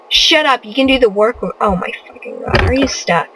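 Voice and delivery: usual speaking voice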